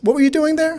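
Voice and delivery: suspicious officer quote. Retold or imitated. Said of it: "He goes".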